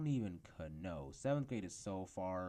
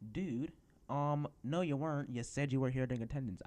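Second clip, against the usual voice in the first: higher, louder teacher snapping; annoyed teacher voice; 6 semitones higher, 6 dB louder, faster than usual. Retold or imitated. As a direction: scold like a fed-up teacher.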